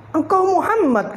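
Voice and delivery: a grandmother who can't believe her ears; shocked old woman. Acted. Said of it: "Sungguh kaget neneknya tadi". (nenek terkejut, suara jauh lebih tinggi dan pelan)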